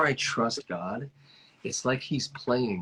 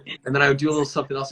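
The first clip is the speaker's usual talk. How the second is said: ordinary talk, nothing marked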